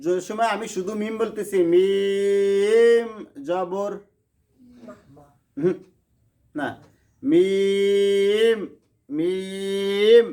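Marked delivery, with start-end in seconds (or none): 1.7-3.1 মিম held very long, nasal hum sustained; drawn-out মিম. Shown as a demonstration. Teacher shows students how মিম alone is drawn out. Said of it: "শুধু মিমে টাইনে ফলতেছি". 7.2-10.3 মিম held very long, nasal hum sustained; drawn-out মিম. Shown as a demonstration. Teacher shows students how মিম alone is drawn out. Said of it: "শুধু মিমে টাইনে ফলতেছি".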